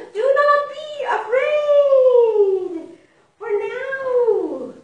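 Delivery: slow, loud, high proclamation; calling voice. Acted. The great voice calling to Mouse, slow loud and high. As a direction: call out across the sky.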